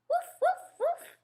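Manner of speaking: very high, giggly puppy woofs; a little kid yapping like a puppy; toddler barking. Acted. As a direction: toddler under the table barking at his family, playful. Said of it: "He said".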